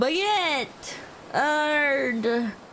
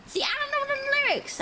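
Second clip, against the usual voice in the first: excited; 9 semitones higher, 3 dB quieter, much faster than usual. Real, not acted.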